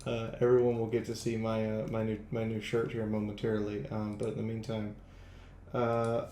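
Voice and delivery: ordinary delivery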